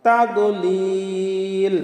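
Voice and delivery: "তাদলিল" with a very long held "লিল"; long madd. Shown as a demonstration. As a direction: chant slowly, hold the last vowel of "তাদলিল" three counts. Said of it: "লিলে তিন আলিফ টান হবে". ("তাদলিল"-এর "লিল"-এ তিন আলিফ লম্বা টান)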